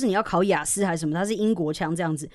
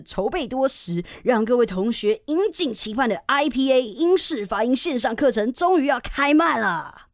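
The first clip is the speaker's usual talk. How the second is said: hyped-up mock course advert, higher and drawn out; 广告标语腔; 5 semitones higher than usual. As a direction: read the slogan like a big launch announcement. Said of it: "那他的那个slogan就是". (夸张的广告宣传腔)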